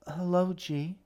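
put-on high, shy little girl voice; a small child answering timidly.

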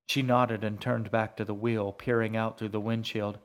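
ordinary delivery, nothing marked

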